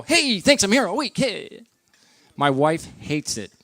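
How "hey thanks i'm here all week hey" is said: high, sing-out punchline tag; milk your own joke in a raised pitch; hammy comedian catchphrase. Retold or imitated. Comedian congratulating himself after cracking a joke, hammed-up high call. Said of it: "I always crack a joke, and then I'll go".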